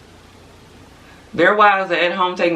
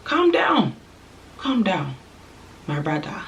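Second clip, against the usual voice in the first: put-on horrible African accent; 2 semitones higher, 4 dB quieter than usual. Telling a show-off man to calm down, bad African accent. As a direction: put on a clumsy African accent, half-joking. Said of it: "That was a horrible accent".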